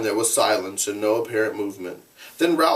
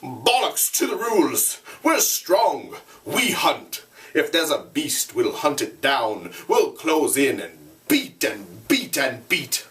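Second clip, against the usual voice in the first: higher defiant shouting; 3 semitones higher than usual. Acted. Jack shouting down Ralph, defiant. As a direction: shout him down with swagger. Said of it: "Jack was shouting against him".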